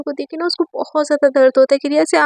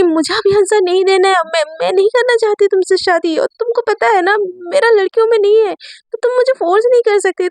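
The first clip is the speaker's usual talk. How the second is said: heated refusal; 6 semitones higher than usual. Acted. Riya refuses Myra's demand heatedly.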